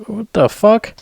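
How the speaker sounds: high, rising disbelief; incredulous what the fuck. Retold or imitated. Reviewer reacting to a cut scene, high and incredulous. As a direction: throw it up in shock. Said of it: "I'm like".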